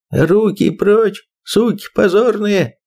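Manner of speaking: high slow furious shout. Acted. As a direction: roar it at them. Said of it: "закричал Ерофеев".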